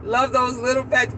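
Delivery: ordinary talk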